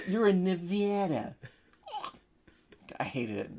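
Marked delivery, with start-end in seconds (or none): 0.1-1.3 slow, smug correction of Nevada with a flat a as in cat; correcting local. Retold or imitated. Mock local correcting the New Jersey visitor. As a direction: slowly set him straight, a touch snooty. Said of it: "let me correct you".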